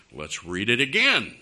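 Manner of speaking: ordinary delivery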